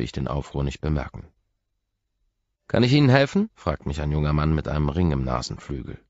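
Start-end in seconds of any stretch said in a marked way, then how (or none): 2.7-3.5 put-on higher, louder young man's voice; polite young receptionist. Young man at the desk greeting the visitor, higher.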